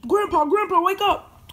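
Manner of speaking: high-pitched calling; urgent wake-up call. Acted. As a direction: call out to rouse a sleeper. Waking grandpa up.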